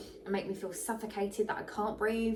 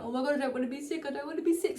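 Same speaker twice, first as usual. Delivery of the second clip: mock panicked worry; 4 semitones higher, much faster than usual. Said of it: "you're constantly thinking".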